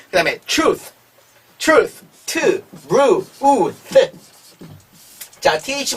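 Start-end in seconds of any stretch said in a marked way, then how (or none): 2.2-4.1 트, 루, 우, 트 as separate slow sounds; sound-by-sound blending. Shown as a demonstration. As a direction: sound out truth as 트, 루, 우, then a final th. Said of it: "트 발음". (트, 루, 우, 트 한 소리씩 끊어서)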